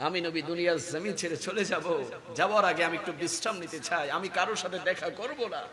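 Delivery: very soft, measured voice; hushed farewell. Retold or imitated. Preacher as the Prophet telling Umme Salma, hushed. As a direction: say it gently, drawing back. Prophet speaks before leaving.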